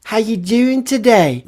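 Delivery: high, drawn-out mock UK lad voice; chavvy British heckler. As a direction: call out slowly from a car window, mocking. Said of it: "pokes his head out of the window and he goes".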